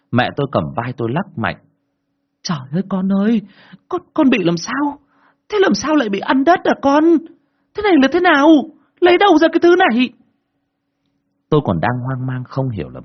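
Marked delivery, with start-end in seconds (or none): 2.4-10.1 very high, loud frantic questioning; frantic mother. A narrator's reading. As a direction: pitch way up and build louder, shaking the child. Mother shaking child, alarmed.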